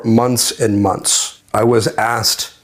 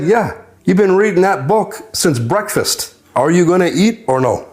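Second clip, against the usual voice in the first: higher-pitched retold prodding; prodding cellmate; 8 semitones higher than usual. Cellmate prodding a man reading since breakfast, high-pitched. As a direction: prod him in a raised pitch. Cellmate prods the absorbed reader.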